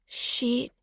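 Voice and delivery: soft, sighing expletive; sighed curse. A narrator's reading. Geordie realising the narrator hadn't been told, soft sigh. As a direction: let it out softly on a sigh. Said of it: "Geordie sighed".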